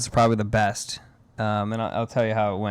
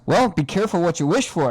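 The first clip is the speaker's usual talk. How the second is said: teasing brother; 7 semitones higher, 4 dB louder than usual. Retold or imitated. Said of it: "in the same breath goes".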